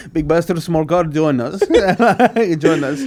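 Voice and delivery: comic café regular, laughing; mock recruiting café man. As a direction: invite him into the gang, hammed up. Acts café men recruiting him.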